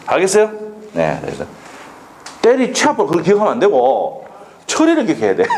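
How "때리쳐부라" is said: high-pitched Gyeongsang dialect. Shown as a demonstration. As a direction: show off the Gyeongsang intonation. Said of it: "경상도 사투리는 뭐라고?".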